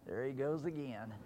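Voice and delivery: a hint of an exasperated God, quiet; weary God. God quietly groaning over a straying believer. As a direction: sigh it out as a tired Father. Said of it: "God would have to say".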